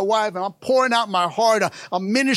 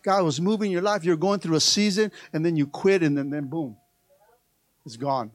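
usual speaking voice

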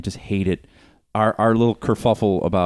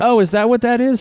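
surprised; 5 dB louder than usual. Real, not acted. Sudden realisation of joke.

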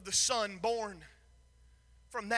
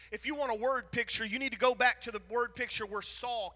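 ordinary delivery

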